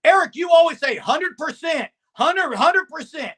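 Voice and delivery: loud, high, heated argument; yelling. Real, not acted. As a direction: yell it back at Eric in self-defense. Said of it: "Sorry, I'm yelling".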